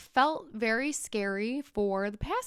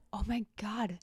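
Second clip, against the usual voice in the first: unvoiced whisper of alarm; 5 dB quieter than usual. Passengers realizing there is no security, whispering in alarm. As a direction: breathe it out in worried disbelief.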